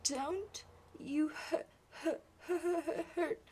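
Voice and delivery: put-on a small boy fighting to get the word out; a boy stuttering at his father; stuttering child. Boy stuttering his first words.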